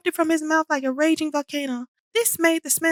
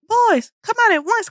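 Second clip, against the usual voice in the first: louder, commanding call through the door; loud stern call; 7 semitones higher, 5 dB louder than usual. A narrator's reading. Principal calling boys out of the toilets, loud and stern. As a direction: call them out with authority. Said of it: "called a loud and low voice".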